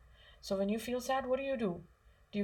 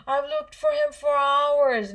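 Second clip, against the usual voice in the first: a slow, high, louder pretend wail; fake crying; 7 semitones higher, 8 dB louder, slower than usual. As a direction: act out teary fake distress to fool the parent. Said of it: "and then you come and you're crying".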